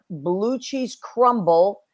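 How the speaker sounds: ordinary talk, nothing marked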